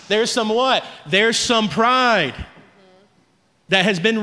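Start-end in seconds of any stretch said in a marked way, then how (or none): 1.1-2.3 slow, loud, drawn pride; slow emphatic pride. Real, not acted. Pastor driving the point home, pride slow and loud. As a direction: lean hard on pride. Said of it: "preaching emphasis".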